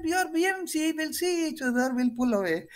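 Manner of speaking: high-pitched, easing down towards the end; breezy pilot call. Retold or imitated. British pilots agreeing to share the bridge, high breezy call.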